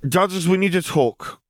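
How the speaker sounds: high, level and unbothered; bored girl voice. A narrator's reading. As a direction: cut in as if nothing matters. Ellie interrupts calmly.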